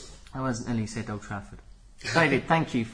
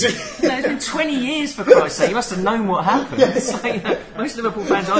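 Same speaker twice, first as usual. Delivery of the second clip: high, loud rant through laughter; laughing rant; 11 semitones higher, 8 dB louder than usual. Real, not acted. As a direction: rant while cracking up.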